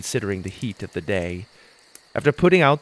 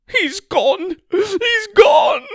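much higher, loud wailing mother; crying out; 6 dB louder, much slower than usual. A narrator's reading. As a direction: wail it in despair. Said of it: "she cried".

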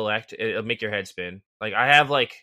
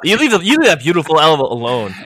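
loud, higher-pitched protest; mock scolding; 4 semitones higher, 10 dB louder, faster than usual. Host jokingly telling co-host off about the elephant, loud mock indignation. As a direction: leap to the elephant's defense, hammed-up.

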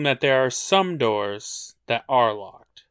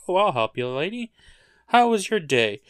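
sugary, higher helpful voice; overly sweet kind act; sweet fake voice; 6 semitones higher, faster than usual.

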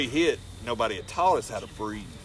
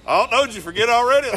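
much higher, louder mocking heckle from the crowd; heckling fan; 11 semitones higher, 10 dB louder, faster than usual. A crowd member answers the wrestlers, loud mocking heckle. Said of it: "somebody from the crowd goes".

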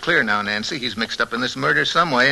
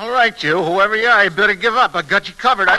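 tough challenge; 6 semitones higher, 5 dB louder than usual. Acted. Confronting intruder in dark.

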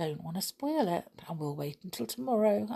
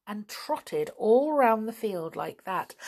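ordinary delivery

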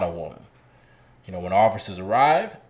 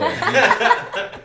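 laughing; 7 dB louder than usual. Real, not acted.

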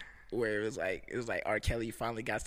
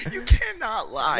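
laughing; 8 semitones higher, 9 dB louder, slower than usual. Real, not acted. Laughing plea.

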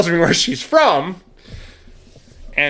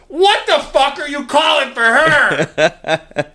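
high, drawn-out hammed-up outrage; mock angry yell; 11 semitones higher, 3 dB louder, much slower than usual. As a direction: re-enact the blow-up, pitch soaring, words stretched. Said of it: "It was like".

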